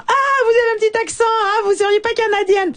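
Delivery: put-on high, fast send-up of a European French accent; mock European French accent. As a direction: play the European who teases her Quebec accent, in a European French accent. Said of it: "il y a toujours quelqu'un pour imiter mon accent".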